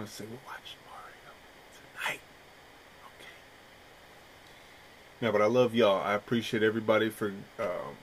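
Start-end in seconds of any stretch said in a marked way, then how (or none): 0.0-3.3 soft conspiratorial whisper; hushed aside. Real, not acted. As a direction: lean in and whisper it like a secret.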